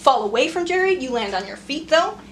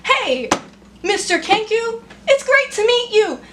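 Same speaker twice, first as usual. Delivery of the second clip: higher, sing-song sarcastic hello; bitter mock greeting; 10 semitones higher, 3 dB louder than usual. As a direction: greet them brightly while confessing horrors. NPC mocks imagined confessions bitterly.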